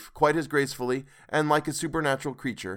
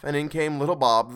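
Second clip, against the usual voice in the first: ordinary delivery, nothing marked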